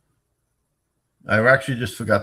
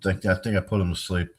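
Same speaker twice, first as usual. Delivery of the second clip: usual speaking voice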